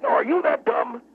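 high, heated comeback; indignant retort. Acted. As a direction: snap the question back, wound up. Guest throws the insult back.

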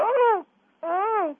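high squeal like a lady on a table; mock cartoon lady fright. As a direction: squeal at the mouse. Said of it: "you go".

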